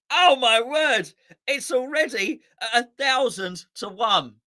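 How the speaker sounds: real disbelief, drawn out then rushed; amazed gasp. Real, not acted.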